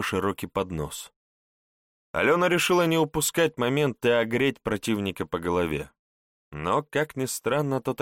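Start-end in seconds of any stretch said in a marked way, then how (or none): none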